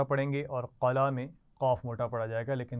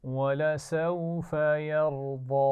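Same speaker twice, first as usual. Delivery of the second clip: teacher chanting Quran for students to echo; Quranic recitation; 3 semitones higher, 5 dB louder, much slower than usual. Shown as a demonstration. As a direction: model the verse slowly for the class to repeat. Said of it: "अगली आयत पढ़ेंगे".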